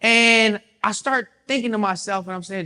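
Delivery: ordinary delivery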